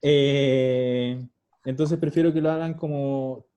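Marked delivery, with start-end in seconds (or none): none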